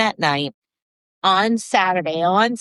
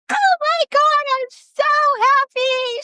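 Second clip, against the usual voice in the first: very high-pitched gushing squeal; imitated elation; slower than usual. Client arriving at the event with plus one, imitated elation. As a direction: gush way up high as a thrilled client. Client's delighted reaction, acted.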